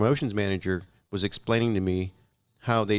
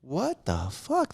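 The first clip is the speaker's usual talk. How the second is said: slow stunned disbelief; 5 semitones higher, 4 dB quieter, much slower than usual. Retold or imitated. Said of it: "I'm like".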